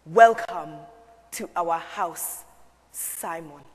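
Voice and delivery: low, slow, stately welcome; father's deep voice, softening to the end; deep-voiced Papa. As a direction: drop the pitch and greet him gravely. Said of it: "laugh his very deep laugh and say".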